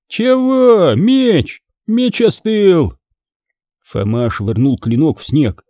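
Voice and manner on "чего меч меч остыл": much higher brash retort; brash young Fomka. Acted. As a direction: answer back cheekily like a brash lad.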